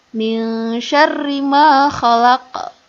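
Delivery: slow chanted tilawah; slow Quran recitation. Shown as a demonstration. Teacher leads class recitation in slow chant. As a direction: intone the verse slowly.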